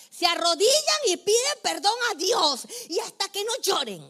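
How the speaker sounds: loud, high scolding shout with a crying edge; scolding the kids. Retold or imitated. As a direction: bark it at the kneeling children, furious. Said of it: "cuando yo regañaba a mis hijos".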